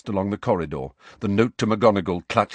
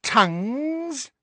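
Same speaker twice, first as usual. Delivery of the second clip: much higher, slow sneering imp voice; mocking poltergeist. A narrator's reading. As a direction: taunt him like a cackling spirit. Poltergeist taunting Harry.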